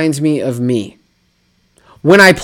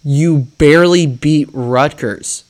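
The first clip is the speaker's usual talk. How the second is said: normal talking voice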